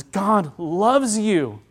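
God loves you, drawn out slowly; slow, deliberate. Shown as a demonstration. Stressing the best message.

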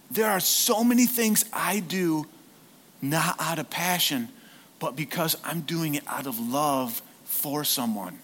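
ordinary delivery

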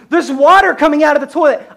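put-on high, loud kid's alarmed yell; retold scream. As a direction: shout the alarm like a frightened kid. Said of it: "I hear screaming".

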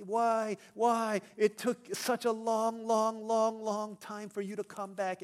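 put-on loud, high child's protest, dragged out; mock tearful wail. Child to parents at pickup, imitated crying complaint. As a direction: wail like an upset preschooler, playing it up. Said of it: "he was so sad, and he was crying".

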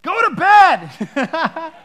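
loud, slow, exasperated order; tired parent shout. Retold or imitated. The parents shout back at their toddler to go to bed. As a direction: yell it like a worn-out parent at three a.m. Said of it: "We're so compassionate".

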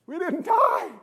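high, strained cry of relief; amazed relief. Acted. Rider to the Lord after the ride, amazed high cry. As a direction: squeal it like a stunned survivor.

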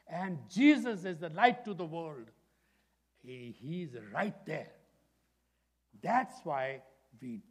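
high, loud fervent preaching; full-force preaching. Acted. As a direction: thunder it out to the congregation.

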